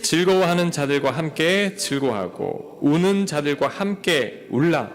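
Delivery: slow, measured reading of a Bible verse, the last words stretched; reading scripture aloud together. Real, not acted. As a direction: read it aloud with the congregation. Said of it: "우리 같이 한번 읽겠습니다". (성경 구절을 함께 낭독)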